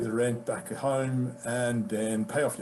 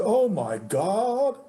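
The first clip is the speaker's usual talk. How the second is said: appalled agent; 4 semitones higher, 4 dB louder, slower than usual. Retold or imitated. Agent hears of client's tour job, mock appalled. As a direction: mimic dismay, high and drawn-out, sinking slowly. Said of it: "she was not pleased with me".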